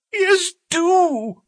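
high, loud tearful plea; pleading. A narrator's reading. Thaddeus begging Holmes inside, high tearful plea. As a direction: beg them to come in. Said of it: "pleaded Thaddeus Sholto".

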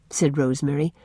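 ordinary delivery, nothing marked

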